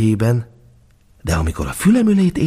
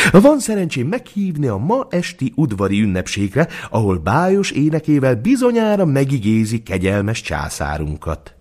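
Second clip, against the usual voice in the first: courtly steward; 6 semitones higher than usual. A narrator's reading. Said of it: "szólt fel neki a főudvarmester".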